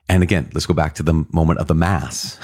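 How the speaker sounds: usual speaking voice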